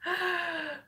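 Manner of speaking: soft, high gasp of delight; admiring gasp. Real, not acted. Showing viewers the pretty lipstick, soft admiring gasp. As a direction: draw in a soft awed breath.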